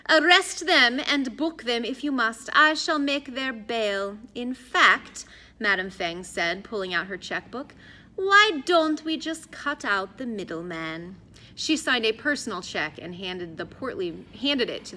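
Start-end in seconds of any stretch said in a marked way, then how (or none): 0.1-5.2 slow, higher-pitched, measured command; stately woman of authority; imperious madam. A narrator's reading. Imperious Madame Feng offers bail. 8.1-11.2 slow, higher-pitched, measured suggestion; stately woman of authority; imperious madam. A narrator's reading. Madame Feng offers a bribe.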